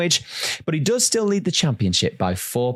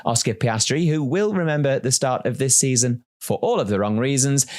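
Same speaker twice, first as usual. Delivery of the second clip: normal talking voice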